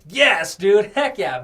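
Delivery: loud, high burst of excitement; retold hype. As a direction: cheer on your own song choice. Retold self jamming to banger.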